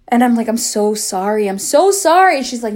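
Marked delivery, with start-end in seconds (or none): 1.5-2.4 higher, louder repeated apology; retold pleading apology, voice raised; emphatic apology. Retold apology, repeated more forcefully.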